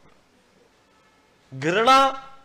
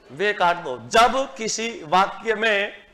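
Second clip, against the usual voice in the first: normal talking voice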